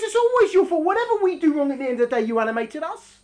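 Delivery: high, loud accusing questions; accusing interrogation. Acted. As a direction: grill the soul, blaming it. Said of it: "So he says".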